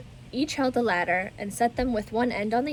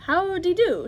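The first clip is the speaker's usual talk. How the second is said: high, louder dreamy greeting; dreamy old lady; 9 semitones higher, 5 dB louder than usual. Acted. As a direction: greet them with a dreamy smile. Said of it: "she said with a dreamy smile".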